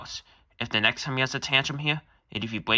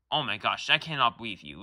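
ordinary talk

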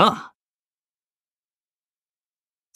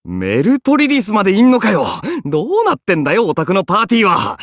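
louder, higher, fast startled complaint; surprised outburst; 7 semitones higher, 5 dB louder than usual. Acted. Startled archer blurting out at the master's party. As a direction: blurt it out, thrown by who's there. Surprised at Meltryllis being here.

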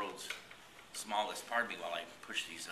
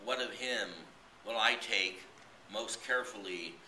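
slow, measured poem recital, higher and louder than chat; poetry reading; 4 semitones higher, 5 dB louder, slower than usual. Acted. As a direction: read it out as a poem, drawn-out phrases with pauses between lines. Recites a poem after chatty introduction.

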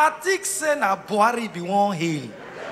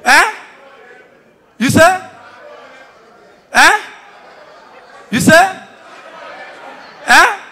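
challenging repeat; 6 semitones higher, 6 dB louder than usual. Acted.

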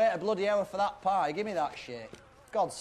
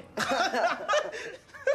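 burst of laughter; 11 semitones higher than usual. Acted. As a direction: howl with laughter at him. Woody laughs at the name.

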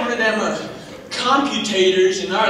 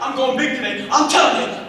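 normal talking voice

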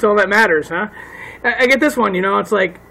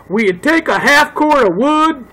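slow, higher put-on southern drawl; southern accent; 5 semitones higher, 3 dB louder than usual. Buyer offers firewood for power tools, southern accent. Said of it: "why do I say that in a southern accent".

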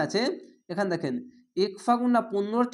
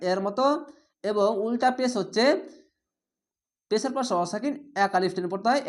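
ordinary delivery

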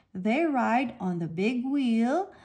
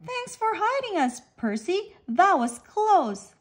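grateful; 7 semitones higher than usual. A narrator's reading. Grateful fish.

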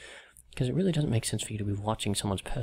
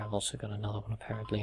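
ordinary talk, nothing marked

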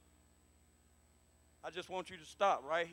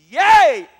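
joyful shout; 32 dB louder than usual. Real, not acted. Preacher cheers during altar call.

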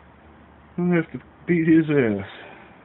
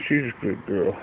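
lower, softer pet praise; 8 semitones lower, 5 dB quieter than usual. Real, not acted. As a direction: coo it down to the cat. Praising the cat softly.

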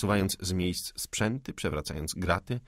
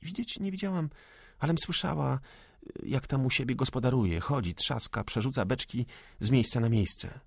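higher-pitched woman's voice; young hostess; 5 semitones higher than usual. Acted. Hostess tells officers of the ghost, high woman's voice. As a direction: answer in a high voice, settling as the tale goes on.

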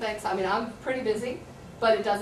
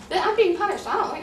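put-on much higher, louder indignant kid voice; protesting student; 10 semitones higher, 6 dB louder than usual. Teacher imitating a student sent to choices, indignant and high. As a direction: play the upset kid who feels punished.

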